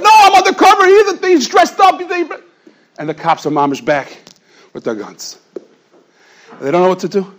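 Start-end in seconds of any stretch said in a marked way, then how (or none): 0.0-2.4 frantic shouting. Retold or imitated. Cop insists to police.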